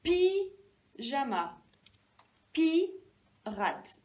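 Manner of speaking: "Pyjama, pirate" with each pi held and separated; first syllable isolated. Shown as a demonstration. Teacher matching two words, first syllable isolated. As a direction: punch pi, pause, finish "Pyjama" and "pirate". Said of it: "J'entends la même chose".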